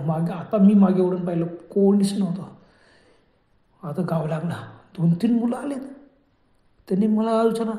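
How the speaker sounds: ordinary delivery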